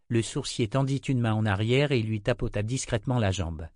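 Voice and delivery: usual speaking voice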